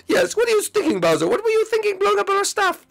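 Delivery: very high, whiny creature voice; tiny creature whining with a hint of crying; high squeaky character.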